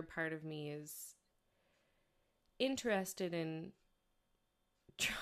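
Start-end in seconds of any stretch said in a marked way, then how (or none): none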